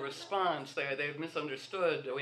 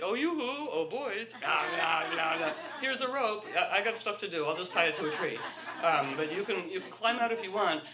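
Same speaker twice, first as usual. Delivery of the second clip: high, teasing yoo-hoo voice, louder; mock flirty sing-song; 4 semitones higher, 4 dB louder than usual. Mocking a flirty caller.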